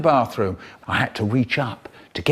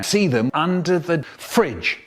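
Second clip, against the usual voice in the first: normal talking voice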